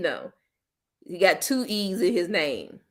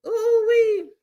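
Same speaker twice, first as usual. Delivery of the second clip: high delighted squeal; high amused ooh-wee; 14 semitones higher, 4 dB louder than usual. Real, not acted. High exclamation.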